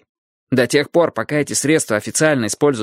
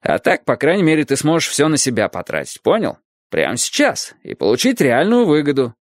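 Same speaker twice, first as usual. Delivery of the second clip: ordinary delivery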